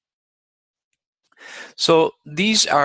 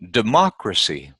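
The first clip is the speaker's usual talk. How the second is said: democracy with MOC stressed; democracy, stressed on the second syllable; stress on third-last syllable. Shown as a demonstration. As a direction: punch the MOC in democracy, then fall away. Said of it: "third syllable from the end".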